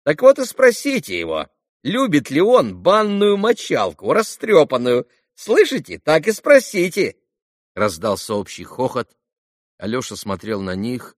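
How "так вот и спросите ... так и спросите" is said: high, loud schoolboy jeer; taunting boy. Acted. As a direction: stretch out the taunt like a mischievous kid. Boys tease Alyosha. (задиристая мальчишеская дразнилка)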